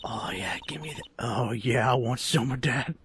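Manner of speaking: low, very soft craving voice; greedy bird. Acted. Playing the bird feasting on his brain, hushed relish. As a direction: murmur it low, as if savouring a meal. Plays the bird eating thoughts.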